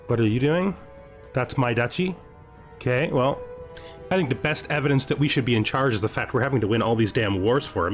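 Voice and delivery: ordinary delivery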